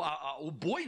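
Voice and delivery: ordinary talk, nothing marked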